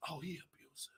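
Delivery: half to himself; low hushed remark; aside. Real, not acted. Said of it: "I'm like".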